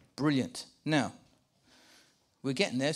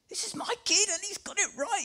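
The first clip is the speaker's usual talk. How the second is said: much higher, giddy proud-dad voice; excited dad; 4 dB quieter than usual. Acted. Preacher imitating a dad proud of his kid, excited high voice. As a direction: squeal it like a hammed-up thrilled father. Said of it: "he's like an excited dad here".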